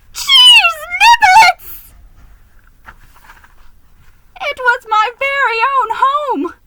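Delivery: building from a worried gasp to a wailing cry; panicked realisation. A narrator's reading. Geronimo realises his house burns.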